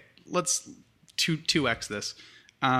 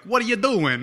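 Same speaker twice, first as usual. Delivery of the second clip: higher "doing" read as "doin'", G dropped; dropped-G accent; 9 semitones higher, 5 dB louder than usual. Retold or imitated. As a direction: say "What are you doing" as "what are ya doin'". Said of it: "written with, like, the apostrophes".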